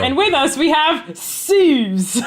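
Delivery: teasing a co-host with a mock Australian accent, laughing; mock Aussie accent. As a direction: ham up the Aussie accent while teasing her. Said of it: "She's like".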